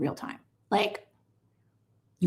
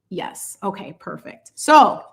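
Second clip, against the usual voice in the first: ordinary talk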